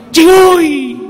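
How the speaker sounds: high, loud mocking whoop; hammed-up rowdy whoop; drunk man's yell. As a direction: shout it back cheekily. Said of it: "dibalas dengan cihuy".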